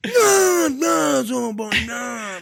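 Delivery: high, loud English countryside whine; English countryside accent. Retold or imitated. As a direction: wail it like a country English yokel. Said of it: "in the countryside".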